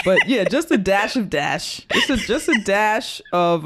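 words broken by laughter; laughing. Real, not acted. Laughing at the weirdo joke.

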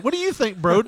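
a hammed-up host voice; high mock question. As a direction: toss the question brightly upward. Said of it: "So it's just like".

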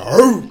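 loud, high bark; frat dog bark. Shown as a demonstration. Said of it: "Like a cue dog".

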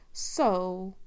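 usual speaking voice

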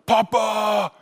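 put-on toddler calling out. Toddler son greets father.

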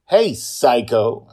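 loud, slow taunting shout; same shouting tone. Acted. Aunt shouting back at the girl, loud taunt. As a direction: sneer it loud. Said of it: "gives her back the same tone".

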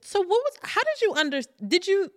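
high, stunned questions tumbling out; can't believe what she just heard; stunned disbelief. Real, not acted. Stunned listener reacting to story.

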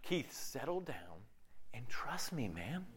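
Jesus speaking low and hushed, nearly whispered; calm him down in a near whisper; soothing hushed voice of Jesus, soft and low. Retold or imitated. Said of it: "Jesus going".

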